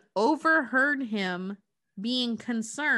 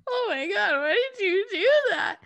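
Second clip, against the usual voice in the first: high, mock-sobbing cowardly lion; imitating crying lion; 11 semitones higher, 4 dB louder than usual. Cowardly lion bopped on the nose by [name], mock crying high. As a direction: whimper it like a big baby lion. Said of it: "the cowardly lion starts to cry".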